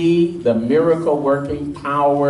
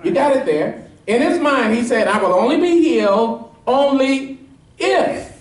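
high, ringing preacher's climb, pressing each point; preaching at full force; 6 semitones higher than usual. Acted. Pastor pressing the congregation on the leper's "If", fervent preaching. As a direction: drive the point home to the congregation.

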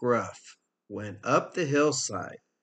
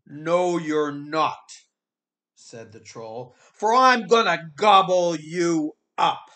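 louder, higher troll threat; troll threatening to gobble the second goat; 4 semitones higher, 6 dB louder than usual. Acted.

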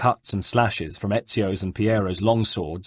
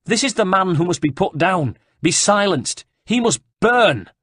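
high, loud, rising call to punish; crying out to the crowd; 10 semitones higher, 5 dB louder than usual. Acted. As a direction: whip up the mob. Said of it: "To the crowd he cried".

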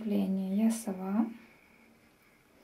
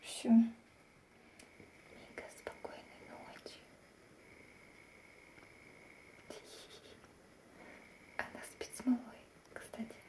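soft unvoiced whisper; 13 dB quieter than usual. Real, not acted. Whispering goodnight, child asleep.

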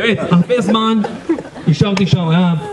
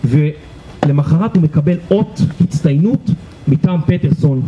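usual speaking voice

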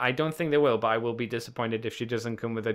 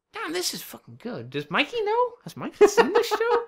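excited inner voice; 4 dB louder than usual. Retold or imitated. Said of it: "I'm like".

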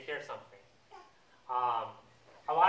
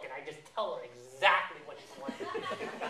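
usual speaking voice

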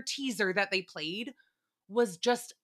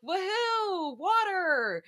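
fake cheer; 9 semitones higher, much slower than usual. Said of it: "Carl's like".

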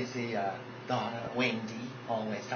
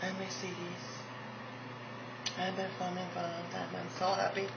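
lightly higher, soft pageant-queen voice; Mercedes impression; 8 semitones higher, 5 dB quieter than usual. Pageant queen Mercedes thanking fans, lightly higher and soft. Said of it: "we got Mercedes Ellis Del Rio".

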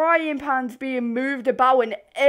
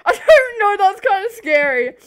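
higher and louder, a real jolt of fright; startled; 7 semitones higher, 8 dB louder than usual. Real, not acted. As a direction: blurt it out spooked.

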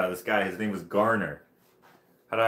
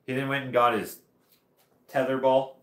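ordinary talk

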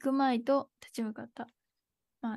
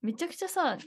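ordinary talk, nothing marked

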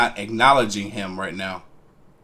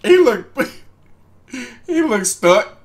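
laughing; 11 semitones higher, 6 dB louder, slower than usual. Real, not acted. Reactor laughing at his face.

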